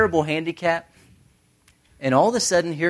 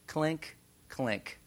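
tiny coin clinks; 3 semitones higher, 12 dB quieter than usual. Retold or imitated. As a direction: mimic two little coins dropping. Imitating two tiny coins dropping.

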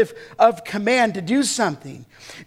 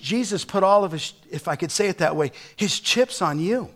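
normal talking voice

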